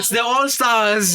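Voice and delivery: milk it like a stage ham; hammy stage actor. Acted. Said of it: "don't mind the theatrics".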